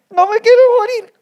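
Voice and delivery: mucho más agudo y fuerte, voz de otro; Elías desesperado. Acted. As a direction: clama como un profeta agotado que suplica. Elías en crisis, voz actuada. (Elías suplicando a Dios, voz aguda y angustiada)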